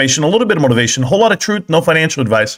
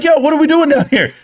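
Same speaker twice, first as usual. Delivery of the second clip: much higher-pitched mock disbelief; retold incredulous question; 12 semitones higher than usual. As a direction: act out walking in on chaos, pitch jumping way up. Retells his own startled question.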